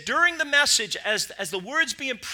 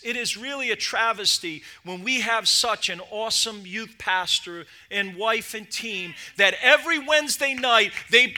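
ordinary talk

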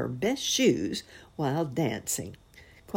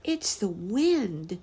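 put-on 1 little boy; 6 semitones higher, slower than usual. A narrator's reading. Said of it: "decided little Fritz".

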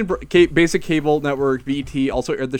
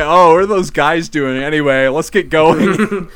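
mock Joel voice; 3 semitones higher, 8 dB louder, slower than usual. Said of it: "And they're like".

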